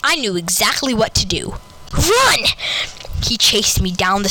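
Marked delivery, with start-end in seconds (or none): 1.9-2.4 high, sudden shout; acted yell of alarm; urgent cry. A narrator's reading. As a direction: cry it out as if fleeing. Narrator cries out to flee.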